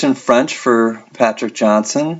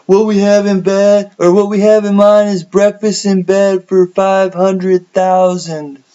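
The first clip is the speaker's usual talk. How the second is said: high, loud festival announcer imitation, slowing at the end; Wavy Gravy; 10 semitones higher, 4 dB louder than usual. Imitating an announcement to the Woodstock crowd, high loud call. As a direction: call out to a huge crowd, pitched up. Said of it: "That's wavy gravy saying, saying that to the crowd".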